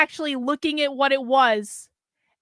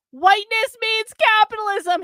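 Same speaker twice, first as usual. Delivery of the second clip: high raised cry; 9 semitones higher, 4 dB louder than usual. Acted. James cries out from the pyre to the world. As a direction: cry it out while burning. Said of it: "he cried".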